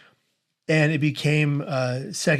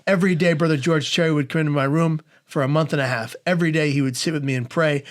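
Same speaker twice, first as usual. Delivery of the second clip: ordinary talk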